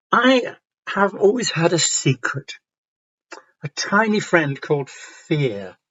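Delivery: slow, measured story narration; picture-book reading. Acted. Teacher reading Me and My Fear aloud, intimate storytelling. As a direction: read gently as if one-to-one with a child.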